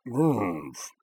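creature grunt. Acted. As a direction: grunt like a big beast.

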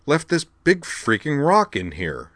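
high, slow, indignant demand; irate. A narrator's reading. Newcomer complaining about the rock in her room, irate. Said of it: "in the same irate tone".

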